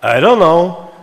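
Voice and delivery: "I don't know" high and loud, on the hummed tune; spoken with the same tune. Shown as a demonstration. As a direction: say it on the same three-note tune. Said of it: "you all know exactly what I said".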